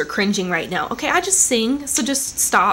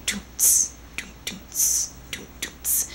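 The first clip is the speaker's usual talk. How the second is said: imitated drum machine; 10 dB quieter, much slower than usual. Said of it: "the drum machine all toot".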